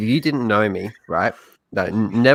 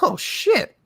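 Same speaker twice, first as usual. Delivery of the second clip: high-pitched mock surprise; retold startled reaction. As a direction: blurt it as if just spotting someone. Retold startled reaction to seeing someone.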